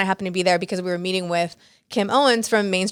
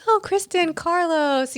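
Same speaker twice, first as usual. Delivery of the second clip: much higher, bright friendly hello; gushing greeting; 9 semitones higher, slower than usual. Retold or imitated. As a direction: greet them like old friends. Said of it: "then she's like".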